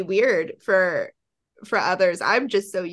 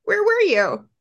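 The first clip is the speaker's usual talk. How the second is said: high, loud disbelief; shocked she was here; surprised; 13 semitones higher, 4 dB louder than usual. Real, not acted. Host realising the guest visited, loud surprise.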